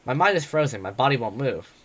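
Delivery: ordinary delivery, nothing marked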